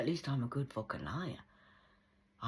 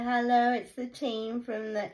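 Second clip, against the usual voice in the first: mock polite caller; 9 semitones higher, 7 dB louder than usual. Mocking fake apologetic callers.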